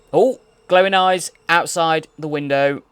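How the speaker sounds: loud excited exclamation. Real, not acted. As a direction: announce it with thrilled energy. Excited spotting the creature.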